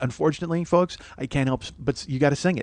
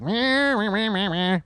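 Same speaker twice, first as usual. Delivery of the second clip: pinched nasal singing, pitched up; 5 semitones higher, slower than usual. Retold or imitated. Comic mocking the older singer live, pinched nasal impression. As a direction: whine the notes through your nose. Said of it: "he sings pinched, like, through his nose almost".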